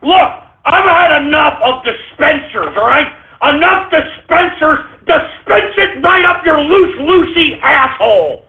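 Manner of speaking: high, yelled abuse at the caller; angry shouting. Real, not acted. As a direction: lose it and yell at him. Host yells at repeat prank.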